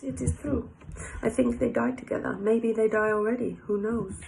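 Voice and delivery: put-on French accent. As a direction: play the Frenchman in broken English. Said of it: "My French accent's coming out again".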